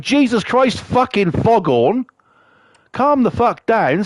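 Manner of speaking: high, slow mock exasperation; drawn-out acted complaint; exasperated reaction. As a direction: protest at the racket, pitched high. Acted reaction to woman's shouting.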